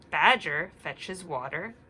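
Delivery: put-on reading to children. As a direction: read it gently to little listeners. Story reading.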